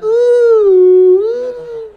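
very high, loud mock squeal; gloating high squeal. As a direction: squeal it in triumph. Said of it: "I'm gonna be like".

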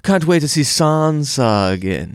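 slow, affected voice saying "sahn-sah"; mock Sahn-sa fan. Host mocking the other pronunciation of Sansa, slow put-on voice. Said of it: "I'm like, "Sansa".

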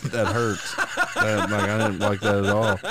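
laughing through the words; giggling while describing pain. Real, not acted.

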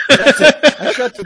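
words squeezed out high and loud through real laughter; can barely speak for laughing. Real, not acted. Telling friends the story, laughing loud and high.

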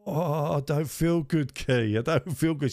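high, slow whimpering moan; whiny.

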